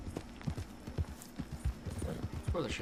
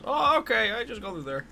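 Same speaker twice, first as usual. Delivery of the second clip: higher, brightly surprised staff voice; lightly voiced security guard; staff member's reply; 7 semitones higher than usual. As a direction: play the startled guard waving him in. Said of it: "I'm like".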